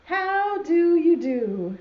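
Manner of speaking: high, slow singsong greeting; duck's greeting. Acted. Duck greeting the rooster in a poem, singsong. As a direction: draw it out in a high storybook voice. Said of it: "says the duck".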